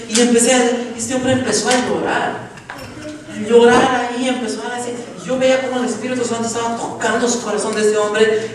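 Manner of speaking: loud, high preacher's fervour; preaching at full force. Acted. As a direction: preach it out, pushing every phrase. (predicación ferviente, alta y fuerte)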